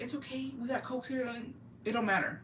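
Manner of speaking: high, soft imitation of the nephew comforting her; mock-sweet consoling nephew. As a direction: coo it gently like a kid reassuring.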